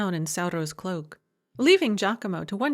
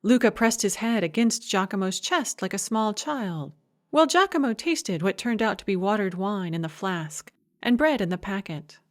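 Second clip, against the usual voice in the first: usual speaking voice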